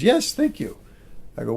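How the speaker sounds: ordinary delivery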